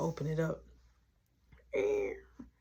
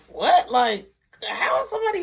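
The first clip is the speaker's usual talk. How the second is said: loud, high disbelief; acted outburst of disbelief; shocked; 4 semitones higher, 6 dB louder than usual. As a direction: blurt it out in shock. Brother shocked car was stolen.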